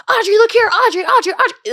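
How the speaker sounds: high, loud, insistent calls for attention; imitated photographers' screaming. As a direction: mimic red-carpet photographers yelling over each other. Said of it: "they scream in your face".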